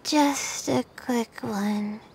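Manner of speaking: drifting off, words dragging; drowsy. Acted. Dozing off before the contest ends, drowsy and slow. About to take a quick nap.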